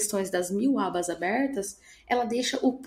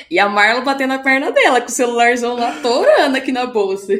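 louder, words riding on laughter; laughing while telling; 9 dB louder than usual. Real, not acted. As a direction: still cracking up mid-story.

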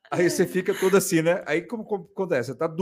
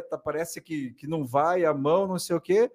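ordinary delivery

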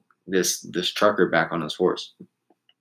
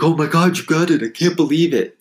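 high, louder amazed gushing; excited guy; 9 semitones higher, 3 dB louder than usual. Retold or imitated. Trucker amazed that the horse was caught, excited. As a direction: gush with disbelief like the trucker. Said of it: "he's like".

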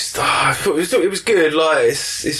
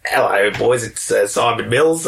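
ordinary delivery

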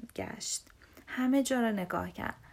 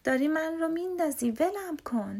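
raised, higher-pitched protest; storybook shout; 5 semitones higher, 4 dB louder than usual. A narrator's reading. Big brother on a branch to little bear, mild protesting shout. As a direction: cry out in mild alarm. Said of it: "برادر بزرگ فریاد زد".

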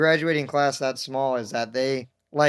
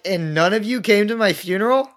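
high, raised-voice incredulous question; mock indignant disbelief; 6 semitones higher, 4 dB louder than usual. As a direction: play up being hurt and appalled. Retold mock outrage at friends.

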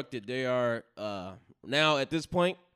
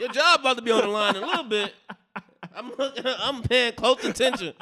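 laughing; 8 semitones higher, 6 dB louder than usual. Real, not acted. Teasing through laughter.

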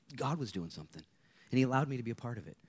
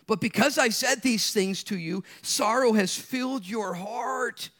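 slow, high, loud proclaiming; preached scripture reading; 12 semitones higher, 9 dB louder, slower than usual. Acted. Pastor reading Jesus' words to the church, slow, loud and high. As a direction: lift the verse slowly and loudly over the room.

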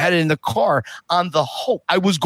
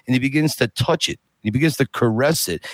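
usual speaking voice

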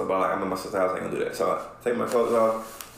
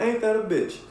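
very high, sarcastic exasperation; exasperated; 12 semitones higher than usual. Retold or imitated. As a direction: drip with disbelief. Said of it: "That was literally my response to her".

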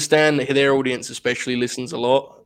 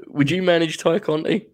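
ordinary talk, nothing marked

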